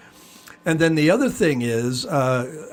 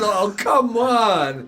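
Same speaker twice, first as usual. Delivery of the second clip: exasperated; 6 semitones higher, much slower than usual. Retold or imitated. Exasperated retold reaction.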